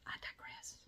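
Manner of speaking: whispered aside. Real, not acted. To viewers, admitting she went off topic in a whisper. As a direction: drop to a secret whisper.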